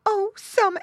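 high, loud mother pig wail; alarmed cry. A narrator's reading. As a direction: cry out in panic for your child. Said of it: "cried Missus Pig".

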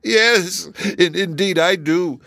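high, tearful, catching voice; stopping her sobs. A narrator's reading. Kangaroo insists to Dorothy she needs mittens, tearful. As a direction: stammer slightly as the crying eases. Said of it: "stopping her sobs".

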